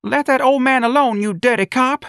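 very high, loud scolding; shrieked high protest from the crowd; woman screaming. A narrator's reading. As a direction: scream it at a policeman. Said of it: "a woman screamed".

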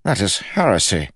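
a touch of outrage; indignant king. A narrator's reading. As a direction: pronounce it as an offended king. King condemns Kell's belief.

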